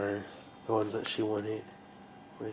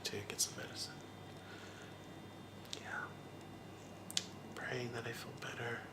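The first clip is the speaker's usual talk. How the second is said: whispering; 15 dB quieter than usual. Real, not acted. Sick, whispering to herself.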